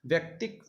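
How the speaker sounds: ordinary talk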